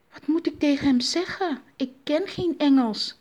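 high-pitched, cross complaint; annoyed. A narrator's reading. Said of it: "zei Ma Akuba boos".

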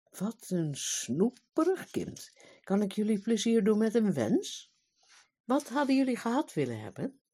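a mighty fairy aunt sweetly offering a wish; syrupy magical aunt; sugary aunt. Acted.

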